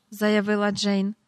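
ordinary delivery

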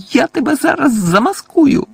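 sudden high, loud shout at him; old woman yelling in threat. A narrator's reading. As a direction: snap back with a furious shout. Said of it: "закричала місіс Фіг".

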